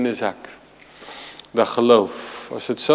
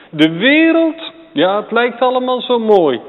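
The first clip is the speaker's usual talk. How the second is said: forceful preaching; 11 semitones higher, 7 dB louder than usual. Real, not acted.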